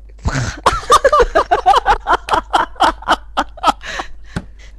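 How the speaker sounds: bursting into giggles; stifled giggles. Real, not acted. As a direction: giggles breaking through the take. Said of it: "ダメだわ".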